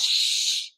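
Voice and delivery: unvoiced shush; soft shushing hiss; playful shush. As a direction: hush the room. Hushing herself.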